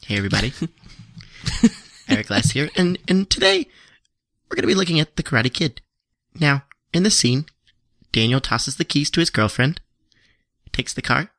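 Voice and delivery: lightly voiced YouTube host intro; mock YouTube reviewer. Reenacting a YouTube reviewer for the podcast, hammed up. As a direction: present it like a movie review channel. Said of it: "So, Act One".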